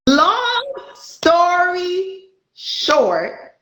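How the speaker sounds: very slow, each word stretched long; drawn-out phrase. Shown as a demonstration. Teasing the host who says no, very slow. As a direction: drag it out to tease the host. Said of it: "No, we're not".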